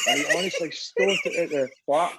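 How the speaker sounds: normal talking voice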